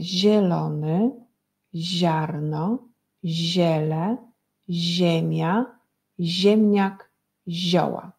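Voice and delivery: drawn-out words, mostly with rising endings; word-list reading. Shown as a demonstration. Teacher reads out the list of words for pupils, drawn out. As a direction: read each word slowly, pausing after each. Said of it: "Odczytamy wyrazy".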